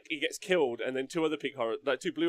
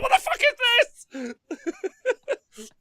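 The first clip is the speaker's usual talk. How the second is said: high, loud baffled outburst; bewildered yell at the chaos; confused Charlie; 7 dB louder, faster than usual. Pretend. Charlie yelling at the battle, high baffled outburst. Charlie voiced, demons popping out.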